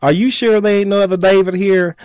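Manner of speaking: high-pitched imitation of a Kentucky customer; doubting customer in a put-on Kentucky twang; mock Kentucky hillbilly. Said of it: "Kentucky hickabilly slang".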